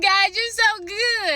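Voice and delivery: high, slow and loud, full of wonder; a delighted, drawn-out cry of gratitude; overjoyed praise. Retold or imitated. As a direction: burst out thanking God. Thankful outburst at a sign.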